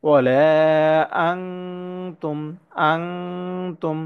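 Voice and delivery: ওয়ালা held long, each আং hummed as ng before তুম; measured recitation lingering on the ng of আং; correct ikhfa recitation. Shown as a demonstration. Teacher lets learners hear it properly, stretched and nasalised. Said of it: "একটু সঠিকভাবে শুনে নেই".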